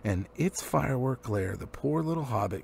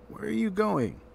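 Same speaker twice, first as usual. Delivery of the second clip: suspicious Thorin; 9 semitones higher than usual. A narrator's reading. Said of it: "in a tone that seemed to show".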